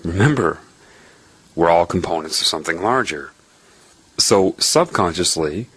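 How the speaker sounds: usual speaking voice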